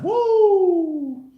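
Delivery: very high, loud woo yell; Ric Flair woo. Retold or imitated. Streamer shouting Ric Flair's woo to chat. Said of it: "Ric Flair".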